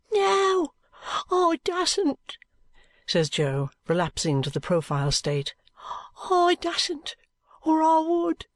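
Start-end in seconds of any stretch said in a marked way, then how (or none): 0.1-2.4 high-pitched frightened urchin; small high voice, balking at telling. A narrator's reading. Jo refusing Allan's plea, frightened high voice. 6.3-8.5 high-pitched frightened urchin; small high voice, balking at telling. A narrator's reading. Jo refusing Allan's plea, frightened high voice.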